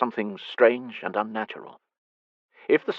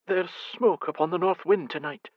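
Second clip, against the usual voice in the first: fretting; 9 semitones higher than usual. Acted. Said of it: "his mother fretted".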